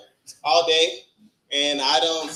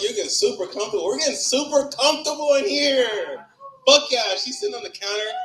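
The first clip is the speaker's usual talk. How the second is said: higher, animated crowd banter; excited riffing; 9 semitones higher than usual. Real, not acted. Comic teasing the crowd for getting comfortable, excited. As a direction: hype up the room with raised pitch.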